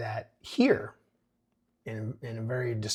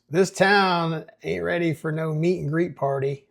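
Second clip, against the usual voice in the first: loud, higher-pitched Texas sheriff; Texas accent; 5 semitones higher, 6 dB louder than usual. Acted. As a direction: read it with a put-on Texas accent. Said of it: "I gave him a Texas accent".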